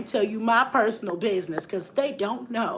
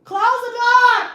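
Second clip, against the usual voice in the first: high, loud, slow yell; 12 semitones higher, 6 dB louder, slower than usual. Real, not acted. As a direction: bellow it down the hall. Yelling at kids to close door.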